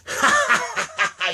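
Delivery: high sudden burst of laughter. Shown as a demonstration. Showing viewers his spontaneous laugh, high cackle. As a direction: let out a sharp high cackle. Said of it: "I spontaneously laugh and go".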